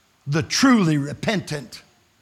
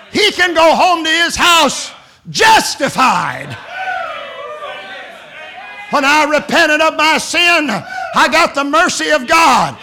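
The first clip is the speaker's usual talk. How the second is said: loud, higher, slow shouted testimony; preaching at full force; 11 semitones higher, 8 dB louder than usual. Acted. Preacher shouting his testimony to the congregation. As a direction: hammer each claim home.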